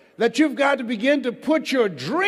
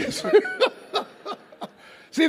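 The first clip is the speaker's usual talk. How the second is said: laughing. Real, not acted. Laughing at audience hands.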